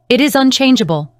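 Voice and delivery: firm, stern and final. Acted. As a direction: lay it down sternly. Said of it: "replied Sophia sternly".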